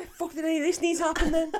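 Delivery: very high, rushed squeal of bafflement; exasperated disbelief. Acted. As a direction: squeak it out in comic outrage. Acted exasperated reaction to the plot.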